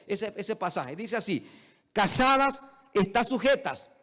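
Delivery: loud, high preaching, slowing on the scripture; fiery preaching. Acted. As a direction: proclaim the verses to the congregation. Pastor preaches at full force. (predicación a viva voz)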